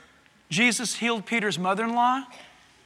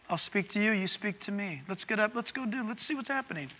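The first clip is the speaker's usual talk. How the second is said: quiet invitation; 10 dB quieter than usual. Acted. God invites a talk together.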